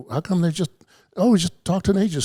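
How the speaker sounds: high, baffled complaint; indignant surprise. Retold or imitated. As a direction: sound outraged and confused. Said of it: "I'm like".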